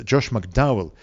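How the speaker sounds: normal talking voice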